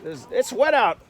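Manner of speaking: ordinary talk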